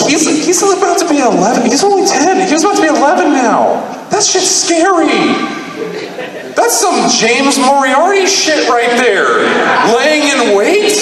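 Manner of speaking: very high-pitched comic alarm; alarmed exclaiming. Acted. Father realising his son schemed for months, high comic alarm.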